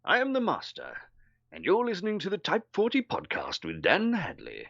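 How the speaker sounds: high, posh villain voice for an ident; host introduction as a grand British villain; Roger Delgado. Shown as a demonstration. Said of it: "so I did a kind of".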